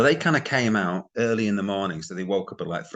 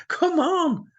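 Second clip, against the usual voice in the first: much higher laugh then come on; scoffing laugh; 4 dB louder than usual. Real, not acted. Vlogger scoffing at YouTubers who claim tough questions, laughing. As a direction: laugh it off in disbelief.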